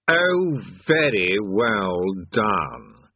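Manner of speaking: very slow, drawn-out praise; effusive old demon. A narrator's reading. Old demon congratulates the king.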